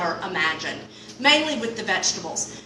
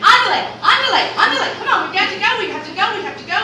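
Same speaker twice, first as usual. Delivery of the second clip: high, loud urgent yelling, fast at the end; screaming; 10 semitones higher, 7 dB louder, faster than usual. Retold or imitated. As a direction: yell the crew along in a panic. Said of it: "start screaming".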